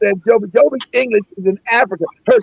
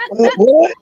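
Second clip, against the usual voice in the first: more stunned each time; baffled what; 9 semitones higher, much slower than usual. Retold or imitated.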